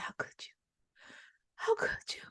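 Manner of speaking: breathless whisper of a betrayed, dying man; hurt whisper, barely voiced. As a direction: whisper it with your last breath.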